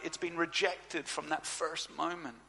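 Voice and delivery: ordinary talk, nothing marked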